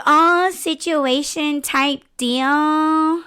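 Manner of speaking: high, very drawn-out affected voice; mock the phrase, stretching each word out; mocking how people talk. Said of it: "When, when people say".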